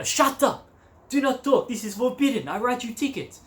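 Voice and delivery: high-pitched French officer's scolding; mock angry policeman. Policeman scolding a hitchhiker on the highway, high mock outrage. Policeman cuts her off angrily.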